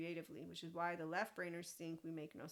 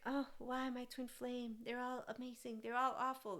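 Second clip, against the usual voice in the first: higher, singled-out put-on voice; mocking imitation; 5 semitones higher than usual. Mocking what twin flame followers think, exaggerated imitation. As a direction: mimic a gushing, overdramatic believer.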